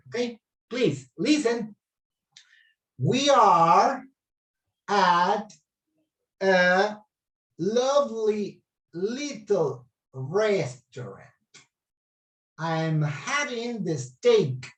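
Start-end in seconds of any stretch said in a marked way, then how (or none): 3.0-14.7 slow, deliberate model reading. Shown as a demonstration. Teacher reads slowly to the class as a model. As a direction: read it very slowly, word by word. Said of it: "I'm gonna read it very slowly".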